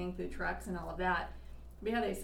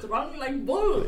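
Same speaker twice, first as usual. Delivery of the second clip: put-on slow, higher, louder Russian accent; 6 semitones higher, 8 dB louder, much slower than usual. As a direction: boom it out slowly in a heavy Russian accent. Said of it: "That's about the extent of my Russian accent".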